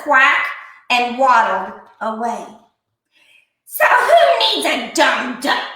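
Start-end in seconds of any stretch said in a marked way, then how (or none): none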